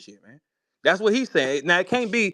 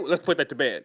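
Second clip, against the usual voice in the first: much lower mocking voice; mimicking robotic talker; 8 semitones lower, 3 dB quieter than usual. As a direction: drop low, half-laughing, to mimic him. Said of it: "That ain't how humans communicate".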